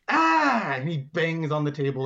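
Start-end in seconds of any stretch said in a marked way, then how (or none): 0.1-0.7 high mock roar; hammed-up "ah" cry; staged finale yell. Alligator bangs the table for finale, staged yell.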